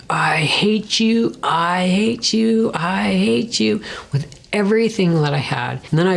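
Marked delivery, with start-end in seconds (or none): 0.1-3.8 mild mock yell, higher and slow, half-whispered; retold yelling. Pounding fists in the grass, a raised, slow cry at the Lord.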